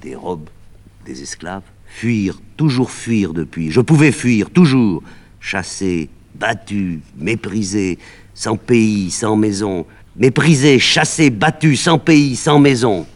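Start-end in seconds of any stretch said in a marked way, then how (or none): 1.9-13.1 loud, high, building rage; bitter outburst. Acted. Médée raging to the nurse about exile, loud and high. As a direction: hurl the words, climbing in anger.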